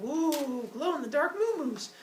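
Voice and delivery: put-on high, cooing little-kid wonder; high baby-talk amazement; awed baby. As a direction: coo it like a delighted toddler. Baby discovers glowing cows.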